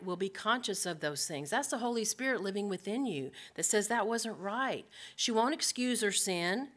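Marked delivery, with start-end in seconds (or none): none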